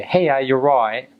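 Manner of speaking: put-on light British accent, friendly back-and-forth. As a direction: toss it off like a local Brit. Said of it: "you say".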